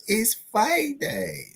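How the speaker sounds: high, slow stretched call; high slow hype call; drawn-out hype. Acted. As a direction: stretch it out like an announcer. Fight-day hype.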